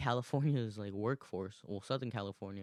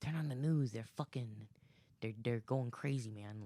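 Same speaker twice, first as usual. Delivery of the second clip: raised, nagging voice from the back of the head; light comedian impression, pitched up at first, easing off; Bill Burr. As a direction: do a nagging comic's voice. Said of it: "that's my Bill Burr voice".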